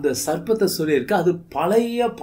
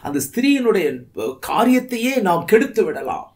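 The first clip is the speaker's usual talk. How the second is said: raised, higher, fervent sermon delivery; preaching at full force; 4 semitones higher than usual. Acted. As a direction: preach it out to the church with fire. Preacher exclaims about the devil's plans.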